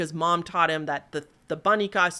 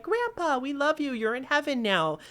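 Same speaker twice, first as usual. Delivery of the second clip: a hint of a gentle mom speaking to the dead; tender mom; 7 semitones higher than usual. Mom kissing the ornament, lightly imitated tender voice.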